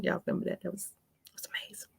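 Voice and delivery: hushed gossipy aside; secretive whisper. Real, not acted. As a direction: lean in and whisper it to the viewers.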